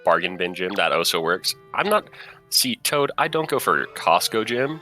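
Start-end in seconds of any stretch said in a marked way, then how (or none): none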